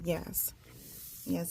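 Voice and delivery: ordinary talk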